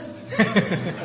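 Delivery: soft laugh at the story; amused chuckle. Real, not acted. Preacher laughs at the stubborn grandma, soft chuckle. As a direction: chuckle warmly.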